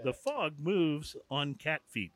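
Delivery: mock-solemn poetry reading, slow and soft; poetry recital. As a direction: read it slowly like a poem at a reading. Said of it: "recite some poetry".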